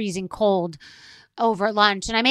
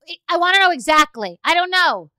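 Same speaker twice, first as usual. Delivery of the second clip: insistent; 8 semitones higher, 6 dB louder than usual. Retold or imitated. Acted demand for exact details.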